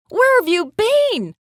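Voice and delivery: high, loud, slow emotional cry; happy, tearful asking. A narrator's reading. Mom reunited with missing daughter, loud overjoyed question. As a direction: exclaim it high and loud, overcome. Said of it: "asked her mom with happy tears".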